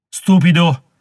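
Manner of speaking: scornful insult. Acted. Warrior insulting his rival in the quarrel, loud and scornful. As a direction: spit the insult at him.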